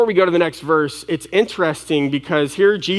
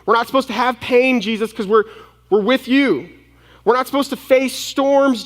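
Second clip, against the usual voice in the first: high-pitched disciple's protest, settling at the end; hammed-up "that's not fair" pleading; acted indignant complaint; 6 semitones higher than usual. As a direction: play an aggrieved follower bargaining with Jesus. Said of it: "where they thought, "Jesus, you're supposed to".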